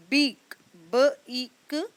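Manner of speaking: each letter sound said apart. Shown as a demonstration. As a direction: split the word into its separate sounds. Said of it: "ई जो है वो अपनी long sound निकाल रहा है".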